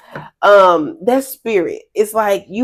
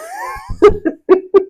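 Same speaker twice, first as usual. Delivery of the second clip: loud high-pitched laugh; high burst of laughter; 3 dB louder than usual. Real, not acted. Laughing at her own bit.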